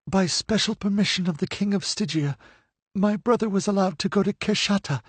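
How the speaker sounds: high, earnest telling of her story; a captive recounting her loss; grieving young woman. A narrator's reading. Livia's voice in narration.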